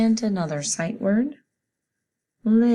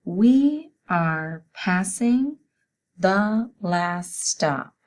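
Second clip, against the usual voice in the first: slow, loud, word-by-word dictation; 4 dB louder, much slower than usual. Shown as a demonstration. Teacher dictating a sentence to students, slow and loud. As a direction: dictate each word slowly to the class. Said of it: "The sentence is".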